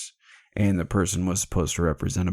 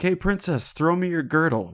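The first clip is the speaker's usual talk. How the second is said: much higher, a casual shouted order; calling out to the princess; 10 semitones higher than usual. Retold or imitated. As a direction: toss the request over like a cocky knight. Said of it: "He's like".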